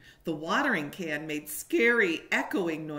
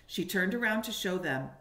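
plain reading voice

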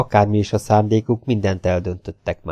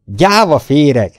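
loud, drawn-out contemptuous shout; kiáltás; 5 semitones higher, 6 dB louder, slower than usual. A narrator's reading. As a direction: fling the insult out slowly and loudly. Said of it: "kiáltott a fiatalember". (megvetően odakiáltva)

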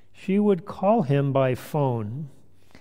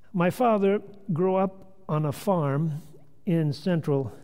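ordinary delivery, nothing marked

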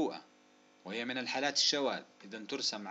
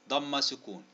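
ordinary talk

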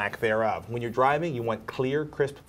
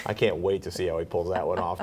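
ordinary talk

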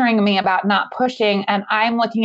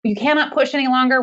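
higher, urgent calls for a doctor; urgent staff shouting; 6 semitones higher than usual. Retold or imitated. Nurses shouting for a room and a doctor, urgent calls. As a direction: shout it up the corridor, mildly. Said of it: "they start screaming".

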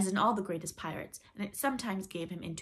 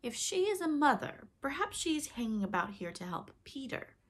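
higher-pitched suspicious opening, then settling; 7 semitones higher than usual. A narrator's reading. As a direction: voice a pirate's growing suspicion. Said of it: "the more suspicious Starkey said".

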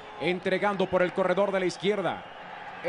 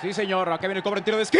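rapid play-by-play; 4 dB louder, much faster than usual. Real, not acted. Rapid corner kick call.

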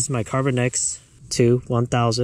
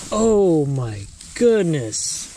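very slow, high, amazed exclamation; freaking out; 5 semitones higher, much slower than usual. Real, not acted. Angler stunned by the bluegill's size, freaking out. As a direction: stretch it out in awe. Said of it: "I was, like, freaking out".

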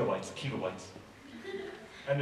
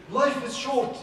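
higher and louder, proclaiming the saying; quoting a saying; 8 semitones higher, 6 dB louder, slower than usual. Retold or imitated. Preacher quoting a proverb to the crowd, raised. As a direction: announce it like a proverb. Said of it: "There is a saying".

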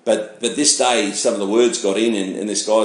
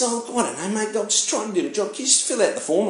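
much higher, softer nerdy clipboard voice; 9 semitones higher, 5 dB quieter than usual. Retold or imitated. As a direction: mimic a timid nerd just doing his job. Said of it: "young little nerdy Flanders looking guy".

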